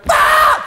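very high, held ape scream; ape cry. Acted. Ape finding itself all alone, high screaming cry. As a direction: screech out like a lone ape. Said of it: "wat roepen apen normaliter".

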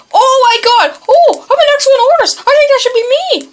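loud, high-pitched outburst; excited shouting. Real, not acted. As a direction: exclaim it at the top of the range. Big surprise in game.

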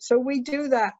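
ordinary talk, nothing marked

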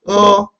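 어 held high and level; teacher models 어 on a high pitch; pronunciation demo. Shown as a demonstration. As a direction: sustain 어 as an isolated sound. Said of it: "이는 어떻게 발음하죠?".

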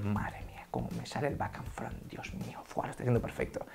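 hushed, delighted self-praise; mock-smug whisper in the mirror; self-impressed whisper. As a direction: whisper it to yourself, amazed at your own trick. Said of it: "y dices".

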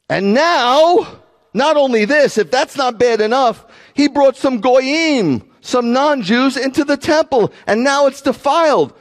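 high, outraged pile-up of charges, acted; shouted accusation. Accusers charging Paul with defiling the temple, acted shout. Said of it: "They're shouting".

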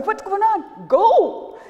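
high, rising alarm into a loud shout; startled. Acted. Rabbit sees giant legs appear, startled high shout. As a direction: caught off guard, yelp it out.

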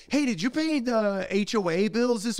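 high put-on wife voice; mock wife voice. Husband imitating his wife asking about bills, high and chirpy. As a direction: chirp it like her. Husband mimics wife's question.

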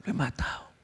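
panicked whisper. Acted. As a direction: whisper it like someone who just killed a man.

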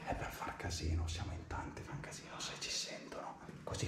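urgent hushed whisper; whisper, don't get caught. Real, not acted. Sneaking, afraid of being heard.

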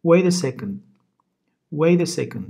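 Wait a second said as wed e sekn, the t softened to a d; flapped t. Shown as a demonstration. Teacher models the flapped t in Wait a second. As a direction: say Wait a second quickly, with the t turned into a d, loud. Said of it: "Buradaki T'yi D diye okuyoruz".

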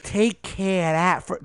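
slow, drawn-out Jersey mumble; Jersey accent. Retold or imitated. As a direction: slur it like a Jersey guy. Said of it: "He's like".

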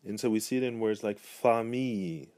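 ordinary delivery

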